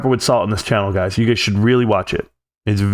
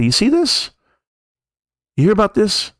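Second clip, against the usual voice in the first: high, eager sales pitch voice; pushy stock tipster; 8 semitones higher than usual. Retold or imitated. Caller pushing a stock tip on an investor, high and eager. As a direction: hook them with a hyped-up tip.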